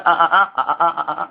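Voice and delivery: stuttered "אה" sounds, halting and repeated; imitated stutter. Rabbi imitating the stuttering student. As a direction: act out the boy stuttering on "אה". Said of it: "ככה מגמגם".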